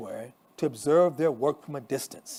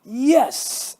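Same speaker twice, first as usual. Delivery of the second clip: high, loud triumphant yes; excited shout; 9 semitones higher, 7 dB louder than usual. A narrator's reading. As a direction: punch the air as you shout. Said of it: "Anna shouts".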